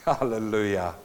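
normal talking voice

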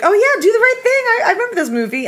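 bright, high-pitched sudden recognition; light up remembering the film; excited recall. Retold or imitated. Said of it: "I was like".

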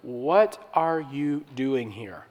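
slow, soft, gentle question; still small voice. Retold or imitated. God asks Elijah softly on the mountain, slow and gentle. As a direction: ask it quietly, like a gentle whisper from God. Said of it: "God speaks, this time audibly".

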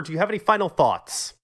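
ordinary talk

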